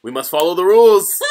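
high, loud rule-follower impression; goody-goody student. Host mimics the glasses student who's always goody goody, loud and officious. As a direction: proclaim it like an officious hall monitor. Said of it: "He's just like, always goody goody".

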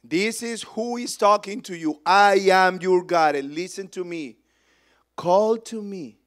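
lightly voiced commanding God. God answering a desperate father's prayer, commanding preacher's delivery. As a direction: declare it with divine authority. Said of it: "this is what the Lord said".

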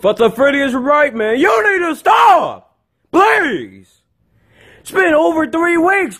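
high-pitched, worked-up yelling; acted furious scolding. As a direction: yell an angry telling-off, voice way up. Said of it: "Quit yelling".

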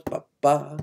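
short ba, then a held ba; syncopated rhythm syllables. Shown as a demonstration. Teacher recalling the rhythm by ear, chanted on ba. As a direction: sound out the off-beat pattern on ba. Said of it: "You know it's".

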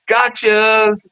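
high, drawn-out mock-gleeful gotcha; spring the joke, pitch way up; prank reveal. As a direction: sing out the big reveal.

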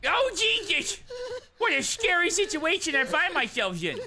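high mock scared cry; mock fright. Caught in a scary game moment, high mock scare.

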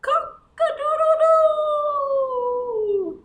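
long, high crowing call; rooster crow. Acted. Teacher crowing like the rooster for the children, drawn-out and high. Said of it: "And it says".

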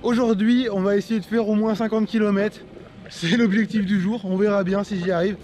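usual speaking voice

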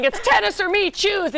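hammed-up shouting ultimatum; mock scream. Actress retelling a fantasy backstage fight, mock screaming. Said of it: "she's screaming".

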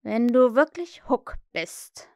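slow, drawn-out coaxing; almost submissive, wheedling. A narrator's reading. Hook tries flattery on the voice, slow wheedling. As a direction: coax it out slowly. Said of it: "sagte er fast unterwürfig".

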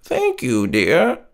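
put-on high, soft old lady voice; frail old lady. A narrator's reading. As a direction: thank him like a frail grandmother. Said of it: "she croaked".

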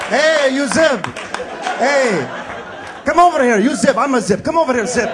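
loud, high shouted hails of Zib; calling out. Retold or imitated. Man hollering at a Zib from down the street. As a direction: yell across the street at a Zib.

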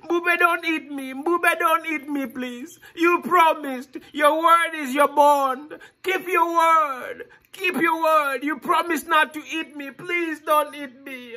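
high, desperate begging; crying plea. Acted. Boy begs the lion not to eat him, high and desperate. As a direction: plead high like a scared child.

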